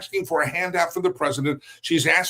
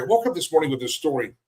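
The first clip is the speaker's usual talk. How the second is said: ordinary delivery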